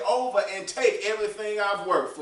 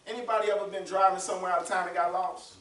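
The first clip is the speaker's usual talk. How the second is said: ordinary delivery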